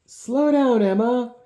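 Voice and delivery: loud, high warning shout; yelled warning. Acted. Man warning the racing girl, loud high yell. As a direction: call out to stop her. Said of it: "Giles yelled out a warning".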